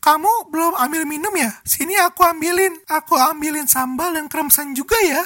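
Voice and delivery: put-on high sugary girlfriend voice; high, sweet doting tone; cute girl voice. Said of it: "Dengan suara imutnya".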